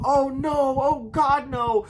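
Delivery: high, loud, drawn-out cry of horror; desperate yell. A narrator's reading. Skydiver realizing he has no parachute, desperate yelling. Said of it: "he begins yelling out".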